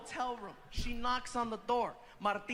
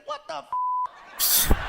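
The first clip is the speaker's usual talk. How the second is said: much higher, cut-off curse then laugh; shocked outburst; 8 dB louder than usual. Retold or imitated. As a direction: catch the curse mid-word in shock.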